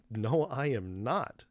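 slow, emphatic "No, I am not"; "No, I am not" drawn out with firm weight on each word; retold firm refusal. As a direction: space out "No, I am not" slowly and deliberately. Retold refusal to entitled customer.

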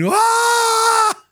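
high, loud imitated scream; hasta çığlığı taklidi gibi scream. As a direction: mimic the patient's sudden shocked shriek. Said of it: "She goes screaming".

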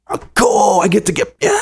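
high, giddy kid impression; excited child. Kid offered pizza and a movie by dad, excited.

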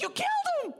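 very high, squeaky whine of a line; comic falsetto Luke, laughing; Luke's reply. Retold or imitated. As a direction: pipe it up like a panicked kid. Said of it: "He goes".

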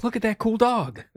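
high, loud gushing delight; excited. Retold or imitated. Player imitates gushing over the dog, high and loud. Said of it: "I'm like".

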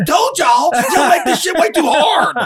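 high, loud coach bragging; mock coach shout. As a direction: crow it like a coach who called it.

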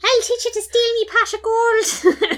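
high, loud Irish leprechaun through laughter. Retold or imitated. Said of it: "making him sound like the leprechaun from Lucky Charms".

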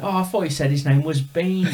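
high, loud Cockney impression; squeaky Cockney explaining; mock Cockney. Imitating the singer explaining his mistake. Singer misheard Ben as Bean.

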